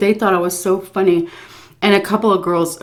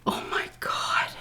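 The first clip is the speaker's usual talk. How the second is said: whispered, slow and mortified; whispering her embarrassment at the story; embarrassed whisper; 11 dB quieter than usual. Real, not acted. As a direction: breathe it out in shame. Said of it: "embarrassed whisper".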